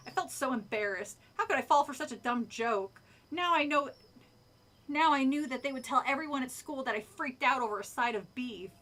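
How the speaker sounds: usual speaking voice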